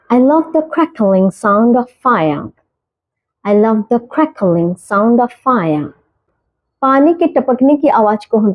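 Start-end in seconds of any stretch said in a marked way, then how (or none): 0.1-5.9 very slow, each word spaced out; slow clear example. Shown as a demonstration. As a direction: model the sentence slowly for learners. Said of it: "example sentence read slowly". (धीरे, साफ़ उदाहरण वाक्य)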